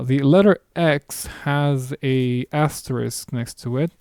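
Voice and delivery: normal talking voice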